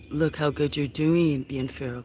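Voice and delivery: ordinary talk, nothing marked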